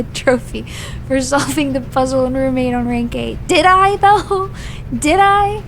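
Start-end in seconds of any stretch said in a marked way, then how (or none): 3.5-5.6 high, loud sceptical retort; loud incredulous comeback; indignant challenge. Real, not acted. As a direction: throw the question back, pitched up. Scoffs at being awarded the trophy.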